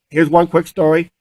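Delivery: ordinary talk, nothing marked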